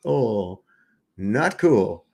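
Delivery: ordinary talk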